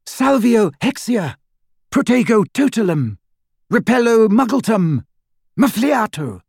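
high, ringing spell chant; spell incantations. Acted. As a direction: call each spell out, high and deliberate. Hermione casting protective spells aloud.

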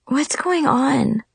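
slow, sleepy and groggy; just woken, groggy mumble. A narrator's reading. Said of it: "I muttered groggily".

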